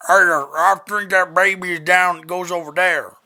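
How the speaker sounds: loud call from across the street; a neighbour yelling directions from his house. Retold or imitated. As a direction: shout it over to a stranger. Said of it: "And he goes".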